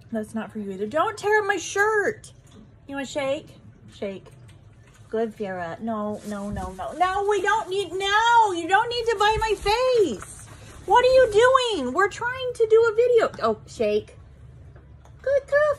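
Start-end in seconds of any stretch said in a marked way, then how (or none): 0.9-2.3 sharp high scold, drawn out; loud scolding. Real, not acted. Repeats scolding louder. 6.9-13.3 high loud exasperated scolding. Real, not acted. Bird bites her face. 15.2-15.7 high praise voice. Real, not acted. Praising the bird.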